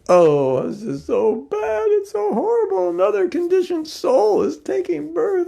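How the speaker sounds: very high, wailing horror; hammed-up lament. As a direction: overdo the grief in a high put-on voice. Acts horrified neighbor lamenting a pregnancy.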